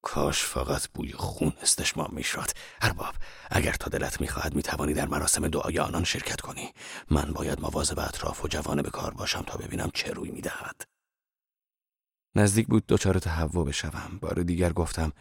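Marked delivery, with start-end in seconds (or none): 0.0-10.8 lower, softer grumbling Zorba voice; a hint of a growling Zorba. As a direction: grumble it low like a suspicious old man. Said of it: "زوربا غرش کنان گفت".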